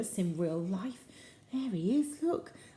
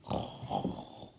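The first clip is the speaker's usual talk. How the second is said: rough, unvoiced pig-like snort; hippo snort; 5 dB quieter than usual. Shown as a demonstration. Storyteller showing children how a hippo sounds, snorting. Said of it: "it was snorting".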